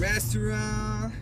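very slow, high drawn-out word; stretched high word. Pretend. (palabra alargada, aguda y lenta)

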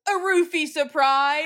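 slow, high, loud announcement; drawn-out hammed-up ta-da; mock gleeful reveal. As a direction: announce it like a party surprise. Acting out woman's alarming reveal.

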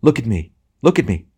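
lady urgently demanding his attention; ringing cry. A narrator's reading. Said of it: "she cried in a voice".

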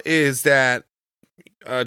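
ordinary talk, nothing marked

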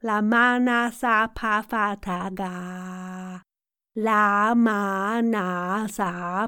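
slow, drawn-out, even syllables at speaking pitch; vocal warm-up. Shown as a demonstration. Coach leading a warm-up, slow level chant. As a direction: stretch each syllable evenly.

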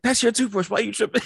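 mock high-pitched protest; retold indignant comeback, voice shot way up; defensive retort. Friend imitates man caught with wrong toothbrush, high defensive protest.

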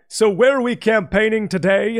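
lightly hammed-up clueless candidate asking his aide; mock politician. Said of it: "and they'll be like".

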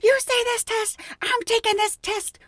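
high, repeated imitated tirade; mock furious rant. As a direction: mimic a coworker losing it. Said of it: "She's like".

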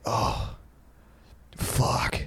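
hushed, breath-only mock realization; dawning regret. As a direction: whisper it as the friend who just realized. Friend realizing what he said.

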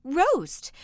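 high, loud, sharp exclamation; ogre's wife's sharp outcry; sharp cry. A narrator's reading. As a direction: cry it out in indignant question. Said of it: "his wife cried out sharply".

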